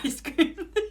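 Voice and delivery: high, slow words bent by laughter; laughing. Real, not acted. Reader joking about indecisive ice cream buyers, laughing. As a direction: giggle the apology out.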